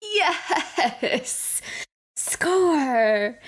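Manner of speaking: high, laughing cheer of triumph; excited cheer. Real, not acted. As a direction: cheer like you just won. Player finds the candy bowl.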